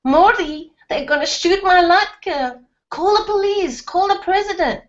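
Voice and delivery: high, panicked pleading; mother panicking as the Air Force threatens her latke; frantic mother. A narrator's reading. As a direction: she begs her husband to act, pitch soaring.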